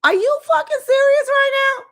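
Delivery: can't believe what you're hearing; shocked high yell; outraged disbelief. Acted. Outraged aside at the dad.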